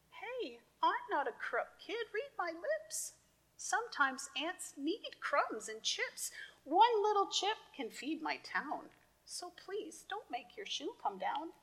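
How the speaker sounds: high, small arguing ant; tiny ant voice. Acted. Ant argues.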